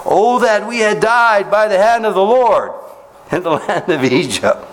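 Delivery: high, slow, pleading complaint; whining Israelites. Acted. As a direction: ham up the grumbling crowd's lament. Preacher voices complaining Israelites.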